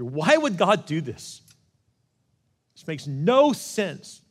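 loud, high outcry with drawn-out key words; reliving a furious, bewildered protest, slow and forceful; angry yell. Retold or imitated. Mad seminary student venting to his best friend on the phone, yelling. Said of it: "I started yelling in the car".

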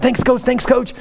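put-on high, eager, boyish gratitude; excited thanks. Jack runs into the game, excited thanks to coach. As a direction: blurt thanks twice, pitched right up. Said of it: "he's so excited".

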